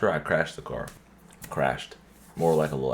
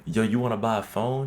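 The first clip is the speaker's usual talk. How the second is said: joking guy; 6 semitones higher than usual. Retold or imitated. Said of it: "He's like".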